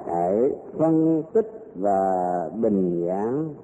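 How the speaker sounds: slow, deliberate dictation; dictating. Shown as a demonstration. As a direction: spell it out for students writing. Teacher dictates test question.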